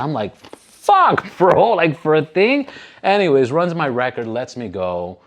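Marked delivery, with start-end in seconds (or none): 0.4-2.7 higher, outraged disbelief; can't believe the threat; reacting to the prison threat in disbelief; shocked disbelief.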